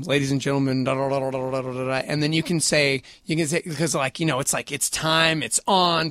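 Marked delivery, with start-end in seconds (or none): 0.0-1.9 low, lightly voiced announcer run-through; ring announcer patter. Shown as a demonstration. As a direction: rattle through a mock announcer intro. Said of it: "Have you ever thought about".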